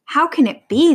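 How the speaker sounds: high, loud question of disbelief; astonished wondering. A narrator's reading. Hunters finding the lion down, astonished wondering. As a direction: gape aloud in surprise. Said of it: "they wondered".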